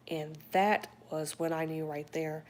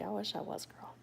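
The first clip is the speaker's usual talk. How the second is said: ordinary talk, nothing marked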